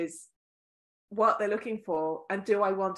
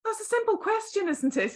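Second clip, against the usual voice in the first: pitched much higher, mock puzzled; mock incredulous; 11 semitones higher than usual. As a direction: imitate a baffled stranger, high. People's reply imitated.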